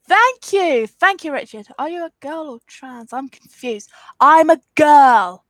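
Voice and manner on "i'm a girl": ordinary talk, nothing marked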